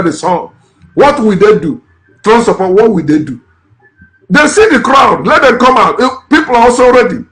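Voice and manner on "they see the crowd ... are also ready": defiant rallying cry. Real, not acted.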